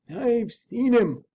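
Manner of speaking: hushed-slow servant's reveal; servant awed. Acted. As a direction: let it sink in slowly as the frightened servant.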